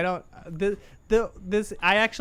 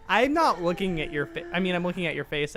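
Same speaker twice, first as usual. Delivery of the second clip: ordinary talk, nothing marked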